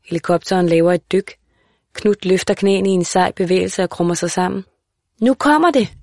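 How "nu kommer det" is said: højt, lyst råb af alarm; råb advarslen op til moren; råbende pige. A narrator's reading. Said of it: "råber hun". (pige der råber til sin mor at broren kaster op)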